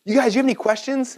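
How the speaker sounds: high, excited rush, imitating an amazed teacher; hammed-up thrilled professor raving to the class; teacher's excited outburst, pitched high; freaking out excitedly. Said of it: "He's freaking out".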